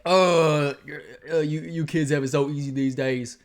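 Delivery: loud, mocking Southern accent of an older person. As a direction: scold the kids in a put-on Southern drawl. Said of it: "I'm gonna speak in a Southern accent".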